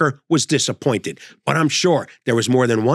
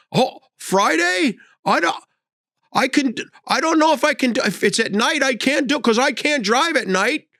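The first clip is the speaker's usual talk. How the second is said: put-on fretful old man; 10 semitones higher than usual. Elderly driver fretting about night.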